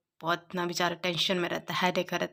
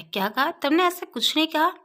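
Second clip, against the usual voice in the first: higher, indignant outburst; angry; 6 semitones higher than usual. Retold or imitated. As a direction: throw the accusation back at him. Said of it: "युवान गुस्से में कहता है".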